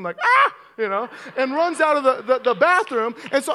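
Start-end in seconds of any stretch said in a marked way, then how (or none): 0.2-0.5 short high shriek, much louder; a sudden shocked shriek; startled scream. Retold or imitated. Nurse finding the fainted patient, shrieking. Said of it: "lets out a little, like, scream".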